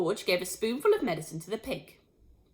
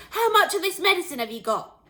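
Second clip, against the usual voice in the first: high, loud, urgent questioning; father shouting a question; shouted question; 8 semitones higher, 7 dB louder than usual. A narrator's reading. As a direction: shout it as an excited demand. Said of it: "Mister Cranky shouted".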